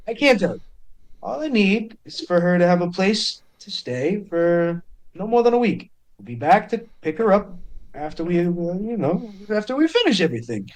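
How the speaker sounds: high, hurried, dodging explanation; evasive Cade. Acted. Cade pleading with Blevin for a week's shelter, high and hurried. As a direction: rush through it, pitch up, avoiding details.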